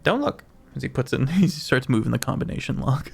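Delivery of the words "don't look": higher, amused little innkeeper; halfling innkeeper. Acted. GM as the halfling innkeeper answering the odd request, higher. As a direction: pipe up as a cheerful halfling.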